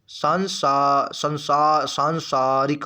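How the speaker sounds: सांसारिक tried in drawn-out pieces, long vowels held; halting restarts before a full सांसारिक; slow sounding-out. Shown as a demonstration. Teacher writing out the word, सांसारिक sounded slowly piece by piece.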